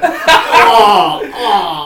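high laughing "oh"; laughing mock shock. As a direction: squeal it through laughter. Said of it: "so I was like".

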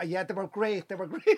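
very soft, evasive mumble; imitated sheepish reply. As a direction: play down the truth under your breath. Retold sheepish reply to the mothers.

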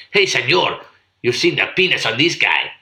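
a hint of a mock Spanish accent; badly faked Spanish holiday accent; vague Spanish accent. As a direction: toss off a half-hearted Spanish accent. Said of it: "I don't know what accent that was".

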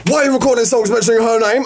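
high-pitched, accusing imitation; ex's complaint.

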